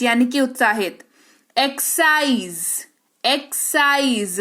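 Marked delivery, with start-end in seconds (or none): 1.6-4.4 एक्साइज slowly as "ek-saiz", the S clear after the K; audible S sound. Shown as a demonstration. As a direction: draw out एक्साइज so the "s" can be heard. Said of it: "स सुनाई दे रहा है".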